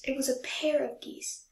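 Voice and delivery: ordinary delivery, nothing marked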